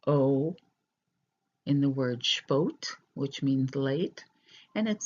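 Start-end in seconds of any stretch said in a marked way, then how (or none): 0.1-0.6 O said as a long pure vowel; a slow model O for learners; demonstrated vowel. Shown as a demonstration. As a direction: hold the O long and rounded. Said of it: "which sounds like".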